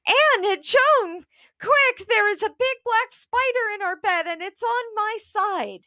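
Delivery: high, panicked mother's squeal. A narrator's reading. Mother calls the girls about a spider, squealing. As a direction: squeal it out high, calling for help. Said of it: "clutching her bathroom and squealing".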